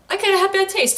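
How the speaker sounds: high, sheepish mock admission; imitating a bashful character; mock awkward girl. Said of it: "She's just like".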